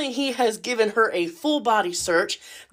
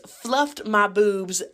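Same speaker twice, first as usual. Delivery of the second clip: usual speaking voice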